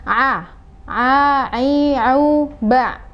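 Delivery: ain from the mid throat; correct ain sounds. Shown as a demonstration. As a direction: voice the A, a, ai, au, ba from the middle of the throat. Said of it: "pengucapannya adalah seperti ini".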